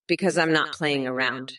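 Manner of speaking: ordinary delivery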